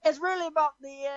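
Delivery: badly faked Aussie, restarting; terrible Australian accent. Contestant trying to resume the argument, badly faked Australian accent. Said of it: "I'm terrible at accents".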